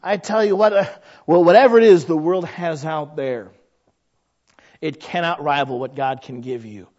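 ordinary talk, nothing marked

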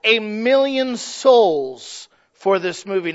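loud, drawn-out proclamation; imitated bold claim. Preacher quoting a televangelist claiming souls, loudly proclaimed. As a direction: declare it slowly and loudly. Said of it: "he said".

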